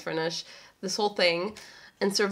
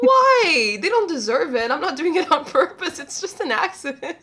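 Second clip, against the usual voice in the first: laughing; 7 semitones higher, 5 dB louder than usual. Real, not acted. Laughing mock defence.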